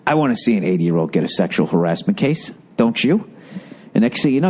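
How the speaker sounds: normal talking voice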